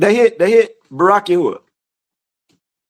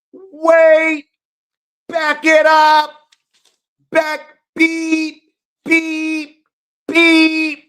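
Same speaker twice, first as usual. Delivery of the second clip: high, monotone reversing beeps; imitated backup beeper; 8 semitones higher, much slower than usual. Preacher stops to revisit the verse, high beeping truck imitation.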